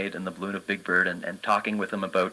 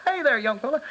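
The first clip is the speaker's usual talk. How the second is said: much higher, sweet birdlike voice; Big Bird voice; 3 dB louder than usual. Retold or imitated. Big Bird greets a doubting little boy, high sweet bird voice. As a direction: greet the kid as a gentle giant bird. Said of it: "started talking like Big Bird".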